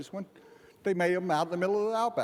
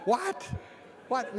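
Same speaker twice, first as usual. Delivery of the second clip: high stunned question; stunned what; 7 semitones higher than usual. Retold or imitated. Friend calls from Denver, stunned disbelief. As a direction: blurt out disbelief. Reacting to the call.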